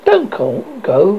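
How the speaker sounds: usual speaking voice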